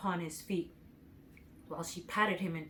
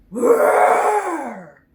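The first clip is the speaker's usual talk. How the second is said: huge high, much louder lion roar; 9 semitones higher, 15 dB louder than usual. Shown as a demonstration. The lion roars from the forest at the travelers. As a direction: let the beast roar out. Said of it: "a terrible roar".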